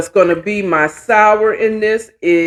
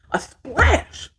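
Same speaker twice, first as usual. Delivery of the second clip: playful splash; 9 semitones higher, 5 dB quieter than usual. Real, not acted. Playful emphasis on the pour.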